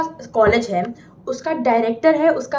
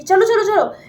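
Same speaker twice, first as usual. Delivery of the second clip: loud, higher play-acted shooing; guard breaking it up; 8 semitones higher, 5 dB louder than usual. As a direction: hustle the quarrelling students along. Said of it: "फिर guard uncle बोलते हैं कि".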